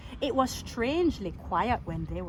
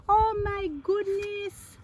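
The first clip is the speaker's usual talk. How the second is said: high, slow drawn-out gasp; mock shock; 9 semitones higher, slower than usual. Narrator reacting to the huge fence, exaggerated surprise. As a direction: sound amazed for the children.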